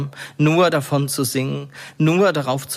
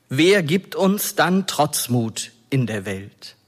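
normal talking voice

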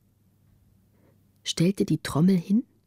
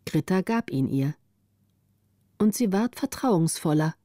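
ordinary delivery

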